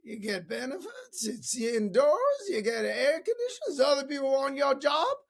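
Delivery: high put-on accent, building to a loud punchline; bad impression that swells into a shout; lousy Zig Ziglar. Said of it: "That was a lousy impersonation of Zig Ziglar".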